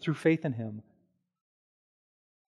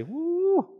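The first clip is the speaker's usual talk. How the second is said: high teasing hoot from friends; teasing woo. Pretend. Friends teasing a flirt, high mocking hoot. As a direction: hoot like friends egging you on.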